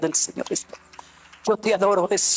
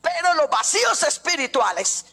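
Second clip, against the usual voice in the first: fervent preaching; 6 semitones higher than usual. Acted. Preaching climax, raised pitch.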